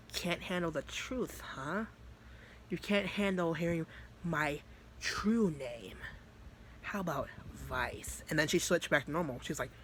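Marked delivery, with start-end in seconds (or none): 0.1-8.2 soft, unhurried taunt; mocking demon. Demon Vice mocking Natsuki's paralysis, softly menacing. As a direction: quietly needle her, a hint of menace.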